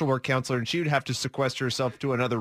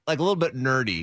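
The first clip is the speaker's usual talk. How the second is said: ordinary delivery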